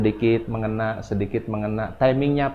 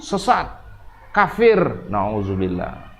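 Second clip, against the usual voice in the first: mock condemnation; 3 semitones lower, much slower than usual. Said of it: "sedikit-dikit menyesatkan orang".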